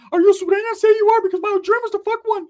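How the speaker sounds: very high, loud comic squeal; imagined cousin voice. Retold or imitated. Cousin with the girl as imagined, high loud squeal. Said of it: "I can imagine him".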